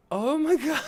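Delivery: high, loud cry of disbelief; real overwhelmed fan outburst. Real, not acted. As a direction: gush it way up high, breaking into a laugh. Overwhelmed by flashback, about to cry.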